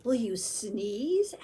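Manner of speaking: slow whispered question; seagull whisper. Acted. Seagull whispering to Chew, slow. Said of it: "asked the seagull".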